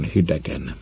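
plain reading voice

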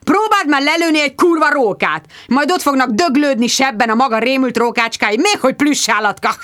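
high, loud scornful squire; scornful shouting. Acted. Squire scoffs at Bridget's plush animal idea, angry. Sir Hugo mocking her idea.

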